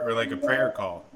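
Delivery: ordinary delivery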